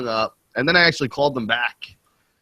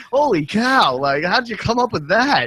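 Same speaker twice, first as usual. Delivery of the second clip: mock amazement; 10 semitones higher than usual. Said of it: "I'm like".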